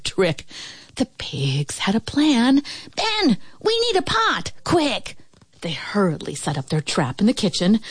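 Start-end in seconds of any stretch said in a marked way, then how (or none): 3.0-5.2 high-pitched, urgent pig call; urgent piglets. A narrator's reading. Pigs need Ben for their plan, high urgent call. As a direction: call for help in a high piggy voice.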